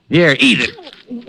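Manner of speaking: slow, high, mocking taunt; defiant taunt. Fired detective taunting his boss, slow and mocking. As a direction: shove the check at him with contempt.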